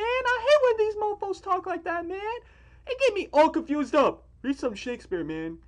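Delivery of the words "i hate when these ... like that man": ordinary talk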